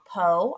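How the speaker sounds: "Po" as a lone syllable with a long O; "Po" sounded out for the class; broken into syllables. Shown as a demonstration. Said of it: "If you can break that down".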